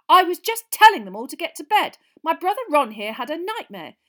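high, loud self-important protest; puffed-up prefect defending himself; indignant. Acted. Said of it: "puffing himself up indignantly".

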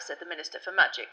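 ordinary delivery, nothing marked

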